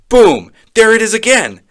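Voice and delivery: high, loud burst; startled exclamation. Acted. Child startled as the voice comes back, high loud exclamation.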